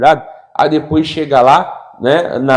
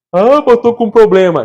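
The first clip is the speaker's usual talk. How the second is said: high, louder mock whine; whiny complainer; 7 semitones higher, 5 dB louder than usual. Preacher mocking a believer who wants pity, high and whiny. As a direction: ham up the self-pity. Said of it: "ela quer que sinta pena dela".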